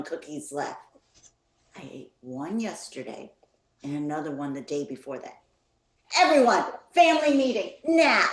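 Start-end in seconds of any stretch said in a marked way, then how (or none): 6.2-8.3 high, loud, fast summons; sharp call to a family meeting; calling everyone. Acted. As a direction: yell it through the house to gather the family.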